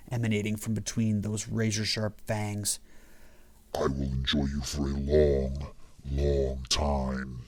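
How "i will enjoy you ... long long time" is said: very low whispered demon voice; demonic whisper. A narrator's reading. Damien promises the doomed intruder in a low demonic whisper. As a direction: breathe it out low and unvoiced, savoring. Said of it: "Damien whispered".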